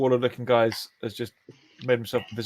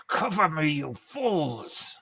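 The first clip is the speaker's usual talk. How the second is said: raised, drawn-out villain's command; villain giving orders; 5 semitones higher, much slower than usual. Acted. Skella weaving spells orders his guards, acted villainous command. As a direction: call to your minions, stretching the last word.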